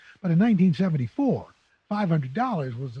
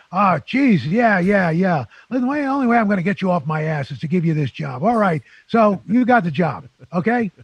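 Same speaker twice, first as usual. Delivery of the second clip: exasperated boss; 3 semitones higher, 7 dB louder than usual. Retold or imitated. Gruff boss gives in.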